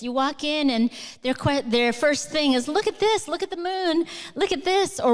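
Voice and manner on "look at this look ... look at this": put-on higher, eager little-kid voice; curious kid voice. Imitating kids calling the visitor's attention, high and eager. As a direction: point at everything like an excited child.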